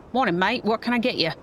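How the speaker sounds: rough attempt at an Australian accent; amateur Australian shopkeeper accent. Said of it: "best I can do with an Australian accent".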